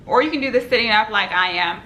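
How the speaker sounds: ordinary talk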